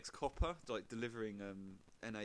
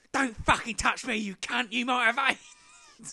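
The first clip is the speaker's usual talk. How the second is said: high furious screech, much louder; old woman yelling; 13 semitones higher, 15 dB louder, slower than usual. Retold or imitated. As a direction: scream it at the drunk like an outraged old lady. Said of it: "and she goes".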